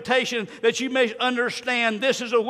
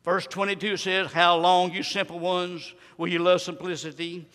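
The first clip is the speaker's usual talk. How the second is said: low, measured verse reading; subdued scripture preaching; 5 semitones lower, 4 dB quieter than usual. Real, not acted. Preacher explaining the simple ones' verse, low subdued preaching. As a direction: expound it in a lowered voice.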